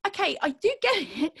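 high, quick inner protest; fed up, rushing it out; exasperated thought. Retold or imitated. Auditionee silently answering the director, exasperated. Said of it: "And I thought".

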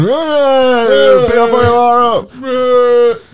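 high, loud mock shout of warning; mock warning yell. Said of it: "I was going".

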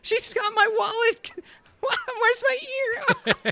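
very high sobbing whine; high whiny crying man. Acted. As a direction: blubber like a hurt kid. Crying thug voice.

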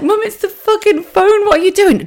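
laugh-yell at a hopeless mum; exasperated. Retold or imitated. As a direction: daughter yelling at her mum over the phone. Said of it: "I was like".

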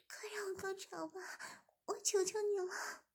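bargain, then beg; high pleading woman's voice. A narrator's reading. Zhimin pleading.

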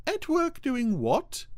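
very high, slow question; theatrical high-pitched lady cow; puzzled Ermintrude. A narrator's reading. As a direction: flute it in a drawn-out falsetto. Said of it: "I want to do the voice".

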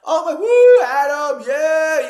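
very high, loud whooping cheer; cheering crowd. Retold or imitated. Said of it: "cheering me on".

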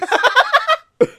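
loud squealing laugh; high burst of laughter. Real, not acted. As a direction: explode into laughter.

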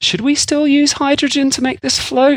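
high, put-on questioning voice; mock naive questioner. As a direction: ask it as a comically clueless planner. Acting out airship makers' doubts.

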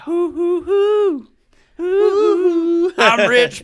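squealing falsetto hoots; wild, high, loud cartoon shriek; silly clown. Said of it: "I'm thinking that [name] bitch".

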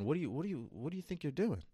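a nagging inner voice, taunting and high; a lightly voiced mocking inner critic. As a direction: sneer like the doubting self inside your head. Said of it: "it's gonna come screaming back and saying".

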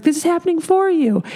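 cheerfully steering them out the door; breezy and kind. Shown as a demonstration. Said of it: "doing it for them".

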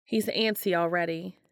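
plain reading voice

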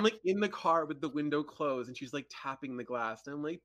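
usual speaking voice

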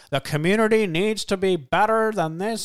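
mock speechifying. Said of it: "He's like".

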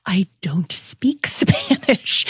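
sheepish whisper with a laugh; a hushed laughing admission; whispered aside. Retold or imitated. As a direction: confess it under the breath. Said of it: "And I was like".

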